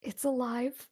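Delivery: high-pitched, frightened warning, held back; terrified warning. Acted. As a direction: warn them fearfully, not yet at full force. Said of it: "she w-, whispers to you".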